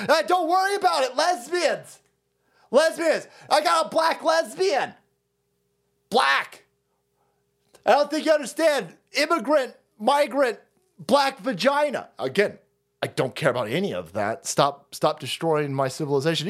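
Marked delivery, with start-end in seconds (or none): 0.1-12.1 sneering mock voice, pitched high; mock dismissive activist. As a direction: wave him off with a pitched-up yell.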